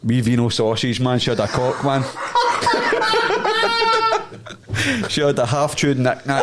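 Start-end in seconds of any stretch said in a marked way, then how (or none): 2.1-5.0 squealing burst of laughter; high giggle. Real, not acted. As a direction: crack up high. Punchline laugh.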